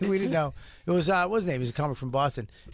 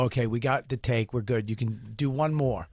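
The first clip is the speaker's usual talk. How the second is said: low crew voice; 7 semitones lower than usual. Retold or imitated. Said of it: "they go".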